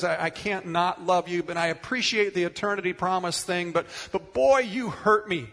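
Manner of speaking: grieving Martha's reproach. Acted. Martha reproaching Jesus at her brother's death, pained and loud. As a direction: cry out your hurt at a friend who came too late.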